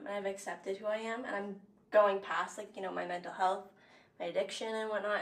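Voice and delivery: soft, low, fighting tears; tearful confession. Real, not acted. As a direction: quietly open her heart. Emotional confession to sister.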